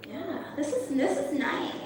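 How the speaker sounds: ordinary talk, nothing marked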